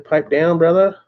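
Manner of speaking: jokey loud callout; playful shout. Acted. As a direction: bark it out like a hype greeting. Greeting viewer playfully.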